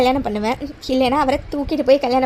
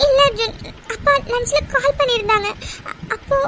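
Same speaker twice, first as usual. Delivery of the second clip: high, halting tearful voice; crying; 8 semitones higher than usual. Acted. Girlfriend confesses the arranged match, crying. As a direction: stammer the news out through tears. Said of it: "இப்படி அழுதுட்டு இருக்க".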